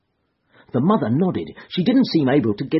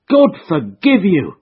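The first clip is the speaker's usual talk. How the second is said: a loud, higher cry to heaven; mother cries out in horror; anguished cry; 6 semitones higher, 7 dB louder than usual. A narrator's reading. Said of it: "cried Missus Charpentier".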